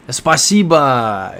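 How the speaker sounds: "Spasiba" slow and loud, spa-SEE-ba; Russian thank you. Shown as a demonstration. Showing off a Russian word to chat, slow loud spa-SEE-ba. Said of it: "Sixth word".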